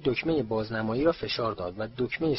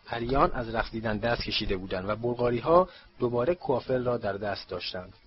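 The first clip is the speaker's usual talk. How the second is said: ordinary delivery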